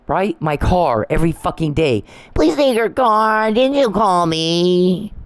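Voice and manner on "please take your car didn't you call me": high, drawn-out mocking whine; whiny, snivelly. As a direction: mimic a sniveling pleader, stretching every word out high. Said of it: "like a fucking whiny, snivelly".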